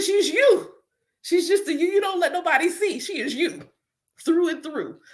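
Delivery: high, insistent voice of her spirit; spirit's answer. Retold or imitated. Her spiritual team telling her, high and insistent. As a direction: answer her like a knowing guide. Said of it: "It's like".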